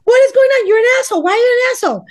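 high, loud cry of outrage; shrieking outrage. Retold or imitated. Wife shouting at her husband about counseling, shrieking outrage. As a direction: yell it at him, fed up. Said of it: "I was like".

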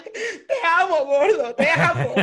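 hammed-up tearful wail, high and loud; mock sobbing declaration with laughter in it; llorando. (llanto exagerado y cómico)

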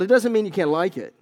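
usual speaking voice

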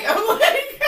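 high, loud laughing retort; laughing protest. Retold or imitated. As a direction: laugh it off, amused. Laughing at friend's remark.

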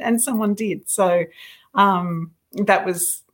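normal talking voice

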